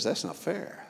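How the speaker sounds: sulky anger. Acted. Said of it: "the oldest son is angry".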